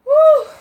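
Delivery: loud, high "whew"; sharp, high-pitched exhale of relief; relieved whew. Real, not acted. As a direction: let out a big high whoop of relief. Overcome with relief at good news.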